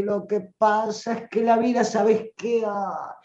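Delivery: hammed-up self-pitying lament, sighing off. Shown as a demonstration. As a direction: moan about how hard life is. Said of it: "Habla lamentándote".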